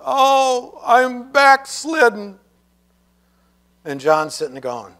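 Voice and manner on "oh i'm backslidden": despairing brother. Retold or imitated. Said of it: "his brother was sitting there going".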